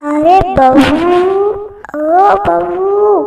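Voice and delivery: slow, stretched call across the house; drawn-out calling. Acted. Mother-in-law calling her daughter-in-law, drawn-out slow call. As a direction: call her slowly and long.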